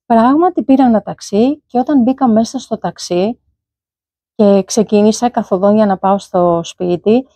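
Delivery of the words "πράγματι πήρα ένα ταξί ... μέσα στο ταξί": ordinary talk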